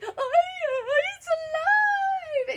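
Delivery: very high, loud, long-wailed shriek; hammed-up screaming woman; terrified lady's scream. A narrator's reading. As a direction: scream in horror, stretching the last word. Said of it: "the lady screamed".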